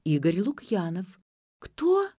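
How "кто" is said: high, startled question; flustered babble. A narrator's reading. Ksyu learning who is calling, flustered babble. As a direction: squeak it out, taken aback. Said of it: "пролепетала Ксю".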